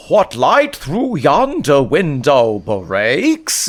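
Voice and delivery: slow, high, hammed-up fake British accent like an American actor; mock fake British accent. Mocking American Shakespeare actors, slow put-on British accent. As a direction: stretch each word in a grand put-on British accent. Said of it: "clearly an attempt to sound British".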